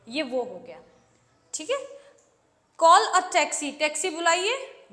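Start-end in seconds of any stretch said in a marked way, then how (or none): 2.8-3.8 loud commanding call; order. Shown as a demonstration. Teacher showing the bare order, loud. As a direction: bark it like a command. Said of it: "यहाँ तक तो बिल्कुल order जैसा लग रहा है".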